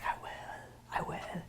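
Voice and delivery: barely audible, entranced whisper; whispered reply. Acted. Thief answering the gold's call, whispering. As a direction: whisper back to the temptation.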